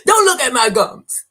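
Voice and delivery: loud, high laughing plea. Real, not acted. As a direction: laugh while begging them. Laughing, embarrassed about gums.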